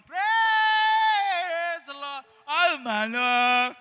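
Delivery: high, loud, drawn-out praise cry; mock praise shout. Preacher imitating his two-year-old grabbing the mic, loud shouting. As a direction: belt it slowly like a tiny worship leader.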